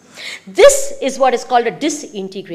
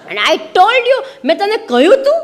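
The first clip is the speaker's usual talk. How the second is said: higher, mock-scolding mother; 8 semitones higher, 3 dB louder, much faster than usual. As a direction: nag in a raised pitch, half laughing. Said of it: "the mother never keeps quiet".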